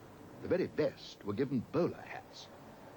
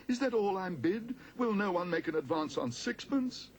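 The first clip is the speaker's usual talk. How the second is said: pleading auctioneer; 7 semitones higher, slower than usual. A narrator's reading. Auctioneer pleading then closing sale.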